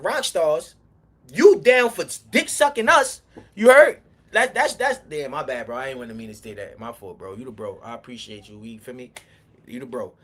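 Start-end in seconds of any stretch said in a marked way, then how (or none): 5.0-10.1 much softer, quick embarrassed backpedal; real apology, much quieter; sheepish apology. Real, not acted. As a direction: drop the voice and take it back. Apologizes after a slip on live.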